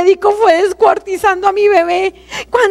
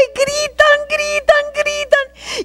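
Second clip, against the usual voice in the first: higher and softer with each word, ending in a sob; fading sob; 6 semitones higher, 4 dB quieter, slower than usual. Real, not acted. Describing the babies' cries to the congregation, fading to a sob. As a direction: let each word die away into crying.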